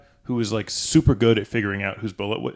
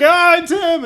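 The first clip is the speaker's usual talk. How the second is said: much higher and louder squeal; high mock squeal; 7 dB louder than usual. Chainsaw victim pointing out the killer in a high squeal. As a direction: squeal the accusation.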